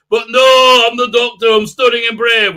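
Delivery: high, loud hammed-up bravado; mock heroic Doctor. Mocking the Doctor's bravado, hammed-up. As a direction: puff it up like a pompous hero.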